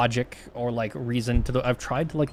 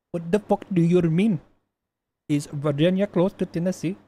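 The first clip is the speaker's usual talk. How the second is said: higher-pitched mock Indian accent, lightly done; 4 semitones higher than usual. Streamer retelling his internet provider call in a mock Indian accent. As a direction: rant about the ISP call in a put-on Indian accent. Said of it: "I don't think my accent's that great".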